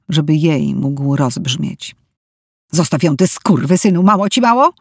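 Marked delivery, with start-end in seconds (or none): 2.7-4.7 angry mother yelling, rising; furious shout. A narrator's reading. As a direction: scream at him in rage. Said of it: "krzyczała". (wściekły krzyk)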